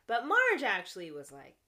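normal talking voice

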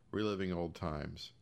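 usual speaking voice

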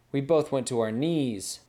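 ordinary delivery, nothing marked

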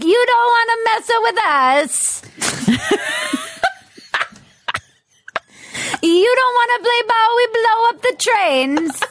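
high-pitched, hammed-up Italian mobster; bad Italian mafia accent. Mobsters warning about the train union, bad Italian mafia accent. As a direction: threaten in a badly faked Italian accent. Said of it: "This is my bad Italian mafia accent".

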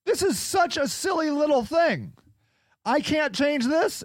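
hammed-up high-pitched whine; mock whiny self. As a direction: play yourself high and defensive. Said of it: "And I'm like".

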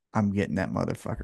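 low, quick, hushed aside; mock whisper. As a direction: mutter it secretly into an ear. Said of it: "have him whisper into Nana's ear".

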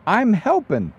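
put-on proud little kid. Father imitating his kid in the flooded toilet, proudly helpful. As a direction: chirp it like a child pleased with the mess. Quoting small child standing on suit.

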